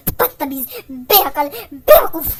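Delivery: angry insults. Acted. Senior girl insults the junior who disobeyed her, furious.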